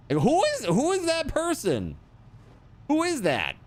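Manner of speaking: really can't believe it; incredulous. Real, not acted. Disbelief at weird jerky.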